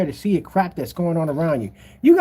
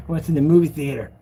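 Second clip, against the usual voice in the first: usual speaking voice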